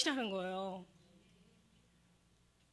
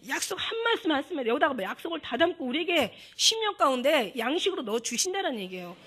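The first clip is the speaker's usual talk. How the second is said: louder, higher impassioned preaching; preaching at full force; 4 semitones higher, 5 dB louder than usual. Real, not acted. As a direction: drive the point home to the congregation. (열정적으로 외치는 설교)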